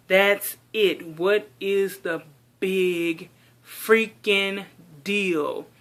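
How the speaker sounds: slow, word-by-word retort; emphatic, fed up. Retold or imitated. Daughter assuring mom she is fine, exasperated and deliberate. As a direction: spell it out slowly, punching each word in exasperation.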